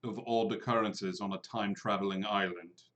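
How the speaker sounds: lightly voiced low, soft character; Mordru, dryly unimpressed. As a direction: answer low and dry. DM voices Mordru answering William.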